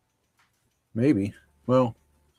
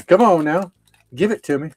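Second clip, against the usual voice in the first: urging; 5 semitones higher, 6 dB louder than usual. Real, not acted. Struggling to open the pack.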